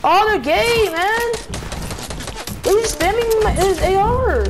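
high-pitched frantic complaint; panicking gamer. Real, not acted. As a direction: yelp it out, cornered and rattled. Being spammed with gunfire.

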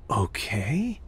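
soft whispered okay to yourself. Acted. Mulling over the virtual town, whispered okay. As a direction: breathe the word out, unvoiced. Quietly taking in the idea.

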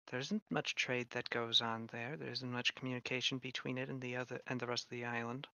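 soft, measured in-character voice; quiet, knowing informant. Acted. Shin telling travelers about an isolated town, quiet and informative. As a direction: share hearsay softly, in a hushed register.

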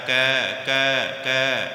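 ਕੈ drawn out slowly with an open ai; stretched ai. Shown as a demonstration. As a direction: repeat ਕੈ slowly, mouth open on ai. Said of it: "ਇਹਨੂੰ ਕੇ ਨਹੀਂ ਪੜ੍ਹਨਾ ਜੀ". (ਕੈ ਨੂੰ ਹੌਲੀ ਖੁੱਲ੍ਹੀ ਐ ਨਾਲ)

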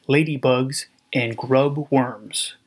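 ordinary delivery, nothing marked

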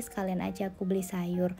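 usual speaking voice

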